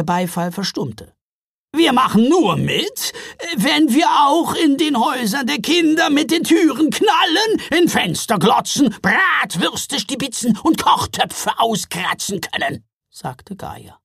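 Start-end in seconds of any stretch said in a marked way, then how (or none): 1.7-12.8 high, loud troll woman; a bold troll mother laying down terms. A narrator's reading. Troll mother Gaja demands.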